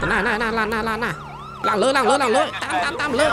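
high, excited repeated calls; urgent call-outs. Real, not acted. Player calling out directions mid-chase, urgent. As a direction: repeat it urgently with the pitch climbing.

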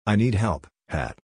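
ordinary delivery

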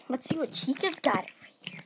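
hushed whisper. Real, not acted. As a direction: whisper it secretly. Whisper while opening a wrapper.

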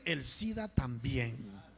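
low, drawn-out, falling repeat; grave, stretched echo; slow solemn repeat. Real, not acted. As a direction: repeat it slowly and low to let it sink in.

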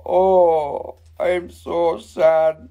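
very slow, hammed-up sad buffalo; drawn-out pretend heartbreak; mock crying. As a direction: wail slowly as the rejected buffalo. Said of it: "He was, he's crying".

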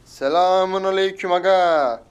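loud, high, long-drawn call; hollered greeting. Pretend. Gamer greeting an opponent, hollered slowly. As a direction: stretch the greeting into a big holler.

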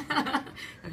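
breathy laugh. Real, not acted. Laughs at the story.

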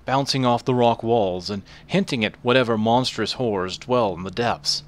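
ordinary talk, nothing marked

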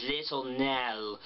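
"Little Nell" with a very wide mouth, slow; "Nell" drawn out with exaggerated wide vowels; Pat Quinn vowels. Shown as a demonstration. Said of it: "when she says the I in Little Nell".

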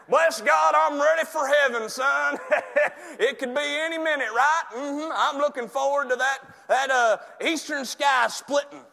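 high, loud hammed-up zeal; fervent believer act. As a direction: shout it like an overexcited churchgoer. Mimics believer longing for heaven.

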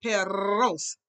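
"perros" with a trilled double r; rolled R. Shown as a demonstration. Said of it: "Your tongue must roll".

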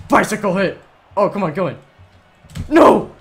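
loud, high play call ending in a yell; excited shouting. Real, not acted. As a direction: urge the shot in, then cry out. Attempting a shot and missing.

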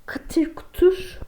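whispered crunching. Pretend. Imitating monster eating them.

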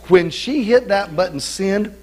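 ordinary delivery

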